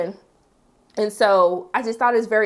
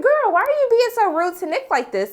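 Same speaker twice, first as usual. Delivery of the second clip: much higher, exasperated put-on voice; exasperated scolding; 11 semitones higher than usual. Recapper scolds June for being rude to Nick, exasperated high voice. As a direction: scold her like a disbelieving friend.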